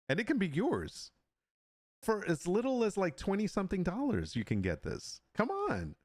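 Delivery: mock sales pitch. Jokingly pitching his print for sale.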